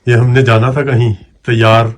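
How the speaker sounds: loud, pushy wake-up calls; loud insisting. Acted. Man loudly urging the sleeping woman to eat. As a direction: push her to get up, loudly.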